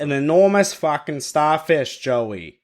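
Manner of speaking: hammed-up Boston wise guy; Boston accent. Podcaster repeating the starfish line to co-hosts, Boston accent. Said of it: "do it in a Boston accent".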